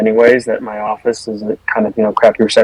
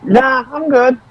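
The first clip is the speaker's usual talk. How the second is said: offhand refusal; 13 semitones higher, slower than usual. Real, not acted. Declines the offered roll.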